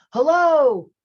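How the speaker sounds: loud high call into a canyon; yelled hello. Retold or imitated. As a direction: shout it out into the canyon. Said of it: "you stand down below and you yell".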